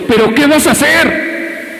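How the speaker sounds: mucho más agudo y lento, casi gritado; reto agudo. Retold or imitated. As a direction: estira la pregunta hacia arriba. Predicador repite el reto. (el pastor reta al hermano tirado, agudo y lento)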